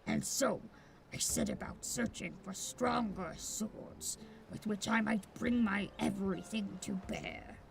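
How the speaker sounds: low brooding swordsman. Acted. Voices a rival swordsman.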